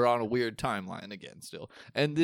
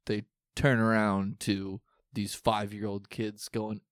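ordinary talk, nothing marked

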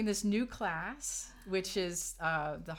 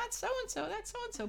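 high, breathy starstruck gossip; excited whispering coworkers. Retold or imitated. Staff spotting a celebrity, high excited gossip. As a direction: squeal it out like a starstruck fan. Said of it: "the other employees going".

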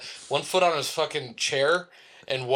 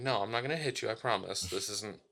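softer, lower, soothing aside; gently promise, tongue in cheek; mock reassurance; 3 semitones lower, 9 dB quieter than usual. Driver assuring a kid in the street, soft mock reassurance. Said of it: "assuring him".

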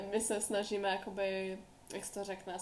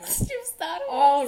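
mock indignant; 7 semitones higher, 10 dB louder, much slower than usual. Mock-indignant answer to a question.

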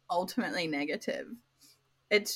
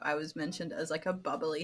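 normal talking voice

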